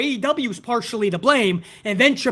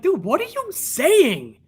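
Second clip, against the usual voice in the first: incredulous; 8 semitones higher, slower than usual. Retold or imitated. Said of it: "And I'm like".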